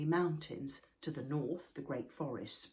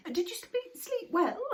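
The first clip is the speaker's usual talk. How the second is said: put-on much higher, louder young troll; Moomin Troll voice; 13 semitones higher, 7 dB louder than usual. A narrator's reading. Moomin Troll politely asking the old earthworm, high-pitched. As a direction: ask it brightly in a high young voice.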